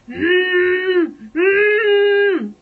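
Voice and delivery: loud, high muffled scream through sealed lips; trapped high "mmm" wail, imitated. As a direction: scream with the mouth shut. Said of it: "it's only coming out like".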